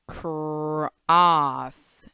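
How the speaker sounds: "cross" with an open ah vowel; short O demo. Shown as a demonstration. Teacher modelling short O in cross. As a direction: stretch "cross" on the ah. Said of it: "then we know that that's ah".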